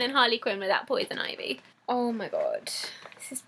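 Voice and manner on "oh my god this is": soft, low gasp of words; awed whisper. Real, not acted. Collector first sees Catwoman figure, awed whisper. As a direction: whisper it, overwhelmed.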